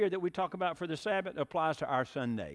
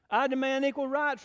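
indignant demand; 10 semitones higher, 5 dB louder than usual. Acted. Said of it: "replied the goose".

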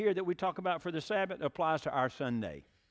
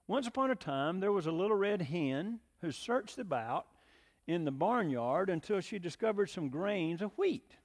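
lively, animated storytelling to the congregation; story reading; 5 semitones higher, 3 dB louder than usual. A narrator's reading. Pastor reads the little red hen fable to the church, animated. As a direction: read it like a children's tale, full of color.

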